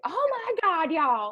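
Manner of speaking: high, exasperated Oh my God, y'all; a hammed-up annoyed complaint with a laugh; mock angry. Shown as a demonstration. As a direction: play it like a fed-up friend. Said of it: "to make it sound angry".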